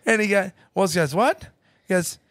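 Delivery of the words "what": high, quick questioning imitation; mock puzzlement. Client asked if he has thought about this, taken aback. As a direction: play him caught off guard, pitched up. Woz questioned by his trainer.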